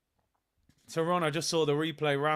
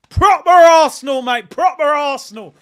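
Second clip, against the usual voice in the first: excited shout; 8 semitones higher, 16 dB louder than usual. Real, not acted. Fan cheering old legends.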